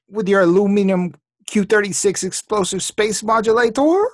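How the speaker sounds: high, thin alien cartoon voice; Marvin the Martian voice. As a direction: squeak it out like a little alien. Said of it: "your Marvin the Martian impression".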